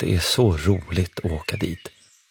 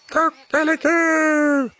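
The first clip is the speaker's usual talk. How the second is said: very high, slow drawn-out cock-a-doodle-doo; rooster crowing; much slower than usual. A narrator's reading. The rooster greeting the visitors with a high, slow crow. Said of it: "gal tuppen".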